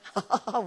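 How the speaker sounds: imitated chuckle of dad; father's laugh. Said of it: "웃으세요". (아버지의 웃음소리 흉내)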